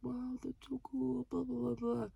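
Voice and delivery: high, soft, slow put-on voice; mocking gush. Mocking the customer's reply about graduation photos, gushing. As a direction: imitate empty gushing in a high little singsong.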